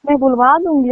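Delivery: usual speaking voice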